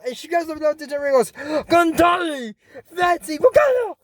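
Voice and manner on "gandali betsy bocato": high battle calls; excited cartoon attack cries; shouted spells. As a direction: call each name out high, like casting a spell.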